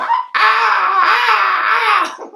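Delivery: high, loud shrieking cries through laughter; eagle-like wail. Retold or imitated. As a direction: screech like a hurt eagle. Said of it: "Sound like an eagle".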